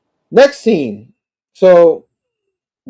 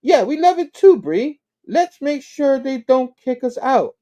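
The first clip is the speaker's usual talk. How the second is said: high-pitched but more contained reply; Randy; 11 semitones higher than usual. Acted. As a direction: humour a drunk friend without squealing. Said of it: "that's Randy. He's like".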